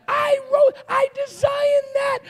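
very high-pitched cry of protest; mock outraged squeal. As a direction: squeal in exaggerated indignation. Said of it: "you're like".

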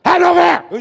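sudden high, very loud yell; God's booming command. Acted. God making the preacher say it, sudden shout. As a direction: burst out as if God forced the words. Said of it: "That was God".

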